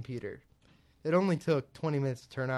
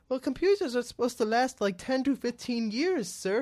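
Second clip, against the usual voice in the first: pitched high and louder, a put-on condescending helpdesk voice; mocking support agent; 10 semitones higher, 6 dB louder than usual. Retelling the support call, the agent lectures him in a mock high voice. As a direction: talk down to the customer, lean on sir. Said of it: "He goes".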